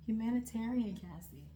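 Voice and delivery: normal talking voice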